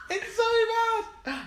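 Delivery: high, helpless laughter through the words; squealing with real laughter; laughing hard. Real, not acted. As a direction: laugh at your own failed attempt. Laughing at own failed accent.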